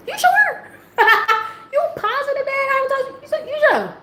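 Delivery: very high, laughing disbelief; incredulous questioning. Acted. Daughter doubts dad's six months, high laughing disbelief. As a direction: question it in a squeaky, amused voice.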